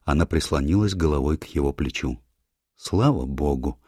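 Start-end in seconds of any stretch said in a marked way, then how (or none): none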